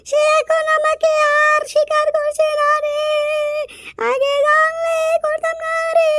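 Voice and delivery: long drawn-out, slow wail; wailing lament. Acted. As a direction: bewail it, stretching every phrase. Woman laments lover's betrayal.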